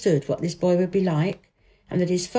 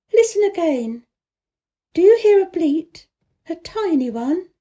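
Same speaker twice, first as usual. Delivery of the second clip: put-on high, louder, excited little girl; eager girl; 9 semitones higher, 5 dB louder than usual. A narrator's reading. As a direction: lift it up with childish excitement. Mary excited in story.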